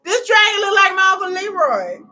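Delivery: big loud punchline; shouted punchline. Real, not acted. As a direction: yell it at the screen. Dragon teeth joke.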